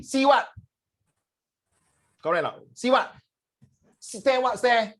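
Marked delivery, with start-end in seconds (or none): 0.0-0.5 high, loud challenging see what; blunt Singaporean retort. Retold or imitated. Teacher mimics a Singaporean being stared at, high and loud. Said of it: "Singaporeans steady". 2.7-4.9 high, challenging see what stare what; blunt Singaporean retort. Retold or imitated. Teacher mimics a Singaporean being stared at, higher. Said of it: "Singaporeans steady".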